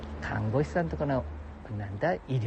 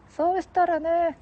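high put-on voice, slow; falsetto second voice; 13 semitones higher, slower than usual. Companion prompting the story, slow high falsetto. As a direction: draw it out in falsetto.

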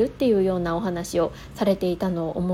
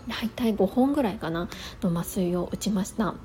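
ordinary delivery

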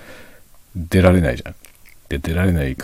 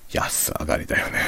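ordinary talk, nothing marked

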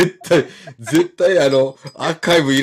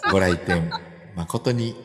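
polite closing-time announcement; store announcer voice. Pretend. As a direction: play the shop PA announcer.

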